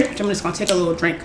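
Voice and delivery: talking with a dry mouth. Real, not acted. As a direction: mouth dry, words a bit sticky. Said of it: "Ah, my mouth is dry".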